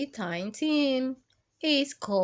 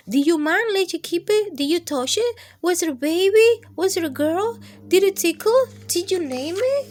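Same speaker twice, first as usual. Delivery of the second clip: higher, louder, fast eager questions; excited kids; 8 semitones higher, 7 dB louder, faster than usual. Acted. Classmates to Ralph, loud excited questions. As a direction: pile the questions on breathlessly.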